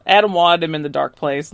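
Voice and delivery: ordinary talk, nothing marked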